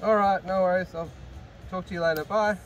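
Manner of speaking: ordinary talk, nothing marked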